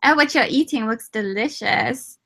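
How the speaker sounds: usual speaking voice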